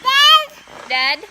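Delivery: high, loud call across the room. Real, not acted. Mother calling the baby's attention to Dad, high and loud.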